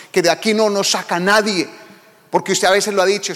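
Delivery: ordinary talk, nothing marked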